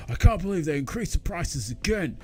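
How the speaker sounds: hammed-up indignant mate complaining; outraged smoker. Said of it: "outraged at least once a year".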